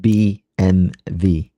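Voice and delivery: B M W slow and loud, letter by letter; attempted German pronunciation. Shown as a demonstration. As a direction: carefully attempt B M W as "bee-em-vee". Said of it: "No, B".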